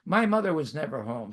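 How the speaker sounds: ordinary talk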